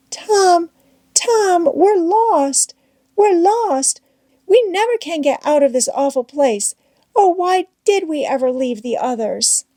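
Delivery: high, despairing Becky; panicked Becky. A narrator's reading.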